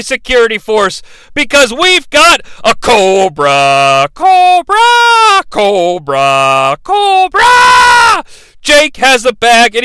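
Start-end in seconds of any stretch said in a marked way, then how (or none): 1.3-8.2 drawn-out ring announcer shouting Cobra; announcer call. Retold or imitated.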